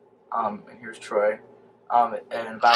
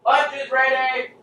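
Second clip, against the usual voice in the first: loud, high drawn-out call; yelling across the room; 14 semitones higher, 6 dB louder, slower than usual. Acted. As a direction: call everyone to the table. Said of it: "we're gonna make the official call".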